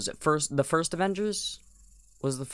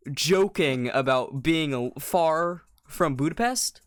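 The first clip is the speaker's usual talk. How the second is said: ordinary talk, nothing marked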